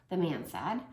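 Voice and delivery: plain reading voice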